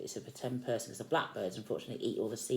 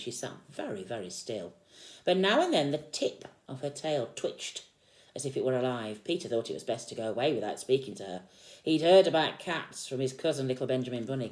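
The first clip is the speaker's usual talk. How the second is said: put-on lively read-aloud to a small child; storybook reading; 3 semitones higher, 7 dB louder than usual. A narrator's reading. Parent reading Peter Rabbit to a child, lively storytelling. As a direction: read the picture book out with energy.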